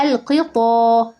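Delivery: "القطا" with heavy, dark T; emphatic ta. Shown as a demonstration. Teacher giving the correct word, "القطا" with emphatic ط. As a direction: stop on the emphatic syllable in "القطا". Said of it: "بالطاء".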